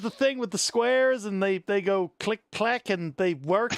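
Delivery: hammed-up Southern old-timer storyteller. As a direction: spin a yarn in mock Southern. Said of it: "ridiculous stereotype Southern accent there".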